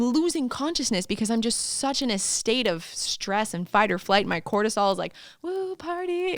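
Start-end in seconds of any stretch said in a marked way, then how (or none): 5.4-6.4 high woo party, less forced; playful mock celebration; mock party cheer. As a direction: toss off a silly cheer. Cortisol voiced as partier.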